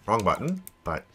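normal talking voice

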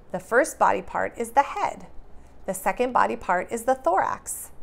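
put-on bright, lively read-aloud for kids; animated picture-book reading; reading to children. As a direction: read it like story time for little ones.

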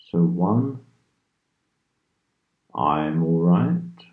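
drawn-out affirmations with long silences between; measured, calming hypnosis voice; guided meditation. Real, not acted. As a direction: count slowly, giving the listener room to repeat. Leads affirmations between counted numbers.

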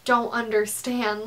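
ordinary talk

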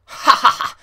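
loud high cackle; gleeful laugh. Real, not acted. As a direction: laugh in triumph. Laughing at trapped driver.

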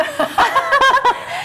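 loud high laugh; high burst of laughter. Real, not acted. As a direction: let out a big shrieking laugh. Big laugh at own joke.

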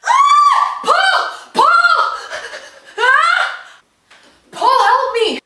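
high panicked scream. Real, not acted. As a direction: scream in terror for help. Screaming at a spider.

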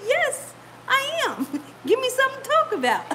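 high, laughing comeback; delighted retort tipping into laughter; gleeful teasing. Retold or imitated. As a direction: crack up while insisting. Teasing husband, laughing as she retells.

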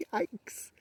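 high squeaky gasp of joy; squeal of delight. Real, not acted. Squeals at triplet mushrooms.

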